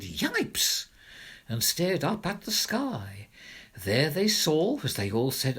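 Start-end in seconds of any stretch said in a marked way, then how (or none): 0.0-0.9 startled yelp. Acted.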